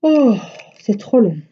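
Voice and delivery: high whiny wail, mock crying; whining child. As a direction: whine it like a bored little girl. Mother imitates whining daughter.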